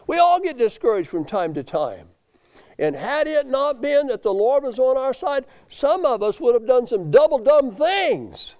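loud, high-pitched pulpit cry; full-force preaching. Acted. Preacher admitting to the congregation everyone gets discouraged, full force. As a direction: drive it home high and loud.

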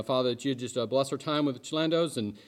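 normal talking voice

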